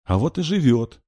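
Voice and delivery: put-on high, loud little-girl retort; high, emphatic child's voice; scared yet self-important. A narrator's reading. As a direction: insist like a frightened, important child. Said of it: "испуганно и в то же время важно проговорила".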